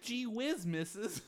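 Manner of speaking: put-on high, dopey little boy; gee-whiz innocent kid. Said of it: "he's just like".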